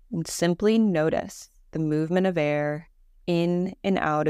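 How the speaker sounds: calm, measured meditation voice with long pauses; guided meditation. Acted. Teacher leading a listener through Yoga Nidra breathing, guided meditation voice. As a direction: guide them gently into rest, evenly and unhurried.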